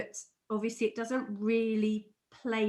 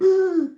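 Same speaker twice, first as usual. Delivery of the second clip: sharp gasp; 9 semitones higher, 10 dB louder than usual. Retold or imitated. Mother screams at spider.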